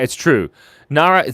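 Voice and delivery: ordinary talk, nothing marked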